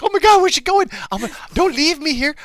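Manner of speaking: hammed-up panic at being left alone; mock panic. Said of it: "did you hear the panic in my voice".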